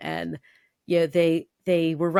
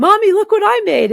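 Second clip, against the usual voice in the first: put-on high, loud excited child; proud kid voice; 10 dB louder than usual. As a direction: squeal it like a proud little boy. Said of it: "And he's like".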